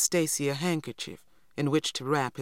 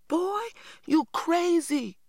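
much higher, slow, disbelieving outburst; girl crying out at her brother, much higher and slow; incredulous cry; slower than usual. A narrator's reading. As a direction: cry out that he's lost his mind. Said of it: "I cried".

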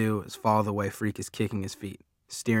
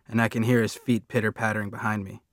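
ordinary delivery, nothing marked

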